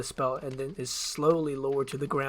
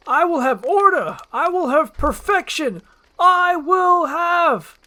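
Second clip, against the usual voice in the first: very high, loud, stretching out; high loud cry, drawn out at the end; raging Farquaad; 11 dB louder, slower than usual. Acted. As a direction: bellow it to the heavens.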